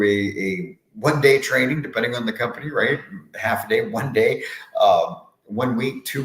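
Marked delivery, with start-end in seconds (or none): none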